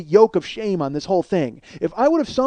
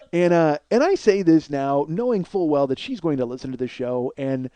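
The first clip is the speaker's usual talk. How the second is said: ordinary talk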